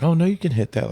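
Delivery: high-pitched mock encouragement, a hint of a pushy cowriter; a lightly voiced cowriter who insists you can hit the high note. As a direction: lightly imitate an eager cowriter, voice pitched well up.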